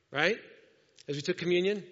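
usual speaking voice

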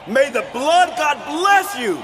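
barbarian warlord roaring to crowd; shouting speech. Acted. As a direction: bellow it like a conquering tyrant.

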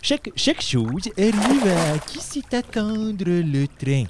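high silly voice. Acted. Swimmer's high voice continues.

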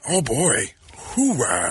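lightly done Pacino grunt; a hint of Pacino's hoo-ah. Showing the host a lightly done Al Pacino. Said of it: "slightly effeminate Al Pacino".

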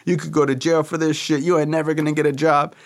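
imitating a yelling father; retold yelling. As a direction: replay dad's rant without real volume. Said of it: "really screaming and yelling at me".

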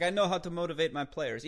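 a lightly put-on Russian accent, imitating an old coach; mock Russian accent. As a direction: coach doing his Scotty Bowman bit in a Russian accent. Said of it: "He would just do that accent".

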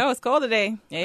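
put-on light Canadian accent, ending on eh. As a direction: slip into a mild Canadian accent. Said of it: "is that a Canadian accent".

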